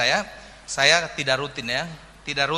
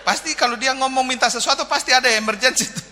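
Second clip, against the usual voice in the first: high, laughing punchline; loud, amused laugh through the words; 10 semitones higher, 4 dB louder than usual. Real, not acted. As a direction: tell it while cracking up. Joke about rare prayers.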